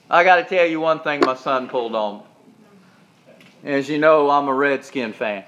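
ordinary delivery

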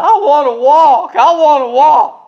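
loud, high-pitched mock bawling; crying wail. Boy in a splint pleading with his doctor, loud mock crying. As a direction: imitate a child bawling at full volume. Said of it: "I'm crying".

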